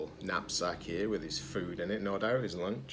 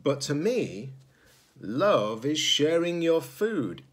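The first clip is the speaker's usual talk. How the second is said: slow, louder fond crow voice; wise crow; 6 semitones higher, 4 dB louder than usual. Acted. The crow sharing its view of love, slow and fond. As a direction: savour the words like a fond old crow. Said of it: "said the crow".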